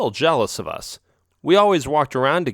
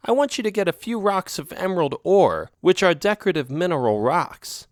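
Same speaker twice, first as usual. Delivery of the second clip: a higher, sweet-talking cat voice; lightly voiced girlfriend cat; 7 semitones higher than usual. Girlfriend cat wheedling her partner, lightly higher voiced. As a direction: coax him with a lightly raised voice. Isabel asks for emerald ore.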